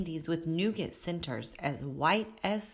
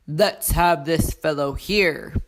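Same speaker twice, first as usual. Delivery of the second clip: Santa; 7 dB louder than usual. A narrator's reading. Santa picks the child.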